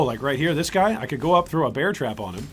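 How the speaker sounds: ordinary talk, nothing marked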